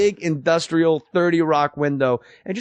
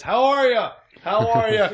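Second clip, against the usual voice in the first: higher, chummy handshake greeting; fifties movie glad-hander; 6 semitones higher than usual. Retold or imitated. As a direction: hand out cigars like a proud new dad in an old film. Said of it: "kind of like in a nineteen fifties movie".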